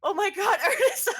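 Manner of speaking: put-on sobbing with relief at seeing an old friend; crying reunion. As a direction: choke it out through happy tears. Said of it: "She's crying and she says".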